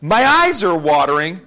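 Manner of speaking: put-on loud, high, laughing defiance of a stubborn boy. Retelling his uncle defying his grandfather, loud and laughing. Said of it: "look at my dad and say".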